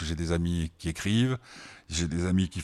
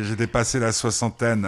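ordinary talk

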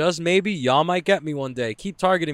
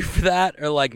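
usual speaking voice

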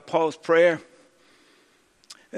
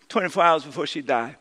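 ordinary delivery

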